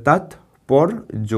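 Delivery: slow, stretched তাৎপর্য; slow syllables. Shown as a demonstration. Teacher sounding out তাৎপর্য for the class. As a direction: draw out তাৎপর্য. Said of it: "তারপর দেখো তাৎপর্য".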